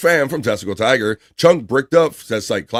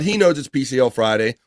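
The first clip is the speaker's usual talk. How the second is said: ordinary talk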